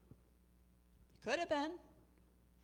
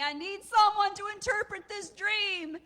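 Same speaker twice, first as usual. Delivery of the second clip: high, wailing outcry; loud lament; 13 semitones higher, 10 dB louder than usual. Acted. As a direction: wail it out to the whole room. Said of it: "he's lamenting".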